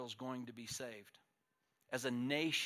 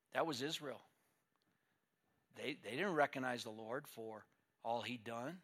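usual speaking voice

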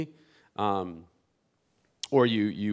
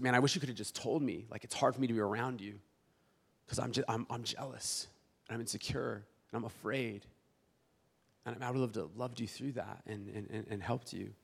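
tender reply; 8 dB quieter than usual. Retold or imitated. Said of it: "Jack said was".

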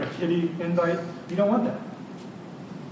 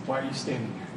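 soft voice of God; 5 semitones lower, 10 dB quieter, much faster than usual. Acted. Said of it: "God's enduring mission says".

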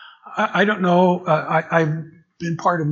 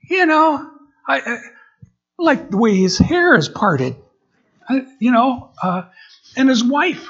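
high, louder mock fussing; disapproving congregant; 9 semitones higher, 6 dB louder than usual. Congregant criticizing the new pastor's hair and wife, mock disapproval. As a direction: imitate a picky churchgoer finding fault.

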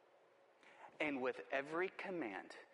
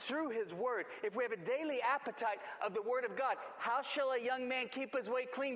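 high, driving preacher delivery; urgent pulpit exhortation; full-force preaching; 9 semitones higher, 4 dB louder than usual. Real, not acted. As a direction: preach it high and hard, hammering each point.

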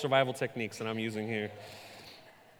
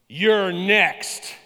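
ominous stranger; 4 semitones higher, 8 dB louder than usual. Retold or imitated. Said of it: "He goes".

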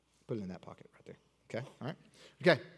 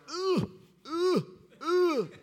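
mock straining grunts; 11 semitones higher, much slower than usual. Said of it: "It was like".

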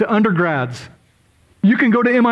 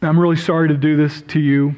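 ordinary delivery

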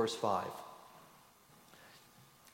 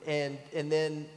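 normal talking voice

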